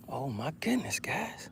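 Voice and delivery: whispered, very soft exclamation; hushed amazement. Real, not acted. Hushed amazement at fish activity.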